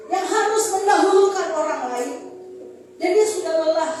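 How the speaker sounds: loud, insistent proclamation; impassioned preacher's complaint on someone's behalf; forceful preaching. Acted. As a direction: press each question home to the congregation. Preaches God's words forcefully.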